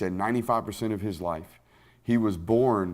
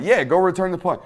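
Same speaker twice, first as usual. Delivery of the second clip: coach's order; 8 semitones higher, 6 dB louder than usual. Retold or imitated. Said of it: "he's like".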